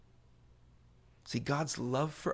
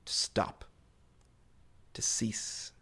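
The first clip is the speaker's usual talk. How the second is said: breathy whisper, softer; 4 semitones lower, 4 dB quieter, slower than usual. Real, not acted. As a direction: whisper the words. Whispered definition.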